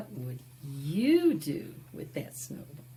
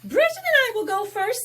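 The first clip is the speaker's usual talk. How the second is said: fast, high and loud; high girl's voice; 10 dB louder, faster than usual. Acted. Chloe volunteering to sled first, high and fast. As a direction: blurt it out like an eager girl.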